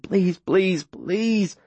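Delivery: high, pleading, near breaking; desperate pleading. Acted. Boy at the riverbank begging for the girl, desperate. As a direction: beg her to be alive.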